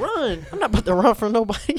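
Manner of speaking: high, loud, fast incredulous protest; indignant yell. Retold or imitated. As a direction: scoff loudly at the idea of running.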